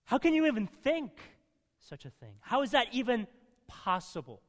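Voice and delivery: a hint of Paul's shocked indignation; indignant disbelief. Paul retorting in disbelief to the sinners' question. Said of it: "basically him saying".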